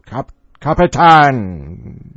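loud, slow, Spanish-tinged shout; shouted in accent. Acted. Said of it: "he shouted".

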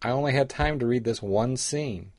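usual speaking voice

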